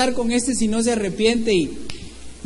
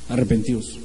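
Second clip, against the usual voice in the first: low, measured prophet's call; solemn low proclamation, spaced out; John the Baptist; 10 semitones lower, 6 dB quieter than usual. Acted. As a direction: call them to repent in a low voice. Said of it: "el Bautista acá, y entonces él".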